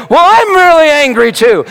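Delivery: loud, high shout of "really angry"; shouted admission. Retold or imitated. Preacher reliving his prayer with Elaine, shouting. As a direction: belt it out as a confession. Retold confession of anger.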